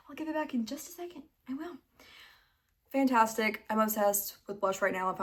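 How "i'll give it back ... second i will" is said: reassuring. Real, not acted. Reassuring the dog.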